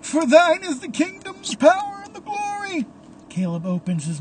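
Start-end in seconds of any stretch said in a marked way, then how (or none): 0.0-2.9 very high tearful wail; crying priest. A narrator's reading. Crying priest finishes the prayer, high tearful wail. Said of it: "The priest crying still".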